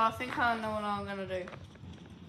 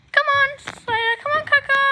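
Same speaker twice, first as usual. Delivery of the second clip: loud, high call to the dogs; 12 dB louder than usual. Real, not acted. Calling dogs excitedly.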